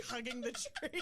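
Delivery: normal talking voice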